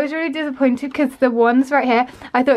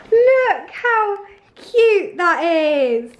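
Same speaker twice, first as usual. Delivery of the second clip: overjoyed; 6 semitones higher, much slower than usual. Real, not acted. Touched by dad's breakfast.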